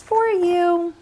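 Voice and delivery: high, lilting for you; sweet little offer. Acted. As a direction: hand it over sweetly. Lily gives flower in character.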